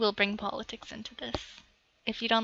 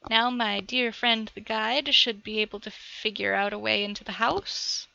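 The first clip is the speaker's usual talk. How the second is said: usual speaking voice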